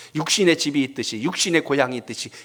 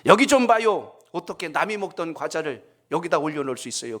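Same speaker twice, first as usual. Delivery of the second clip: angry customer; 3 semitones higher than usual. Retold or imitated. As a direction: imitate a diner complaining to staff. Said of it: "막 화를 내는 거예요". (화내며 따지는 손님 흉내)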